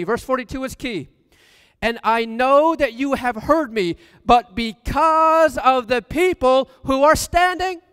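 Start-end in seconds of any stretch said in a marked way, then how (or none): 1.8-7.8 louder and higher, rising into a full preaching cry; fervent prayer. Acted. Preacher voicing Jesus praying before the crowd, fervent loud cry. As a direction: lift it up like a prayer to heaven.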